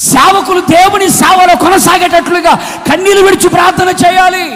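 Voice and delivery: loud, rising sermon cadence, pleading with the crowd; full-force preaching. Acted. Preacher urging the church to pray with tears, fervent and loud. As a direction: drive each phrase home like a revival preacher.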